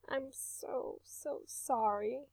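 soft, hushed tender sympathy; mother soothing her crying son in a near whisper; gentle comfort. A narrator's reading.

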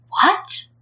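breathless high gasp of a question; like the floor dropped out from under him; stunned disbelief. Acted. Clan leader staring at the couple, asks in breathless high disbelief.

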